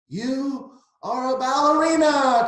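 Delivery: high, loud, slow excited cry. Acted. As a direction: cheer it out together. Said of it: "cried Clara and Jack".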